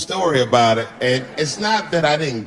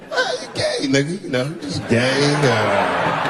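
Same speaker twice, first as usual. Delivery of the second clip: mumbling; slower than usual. Retold or imitated. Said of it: "I started mumbling again".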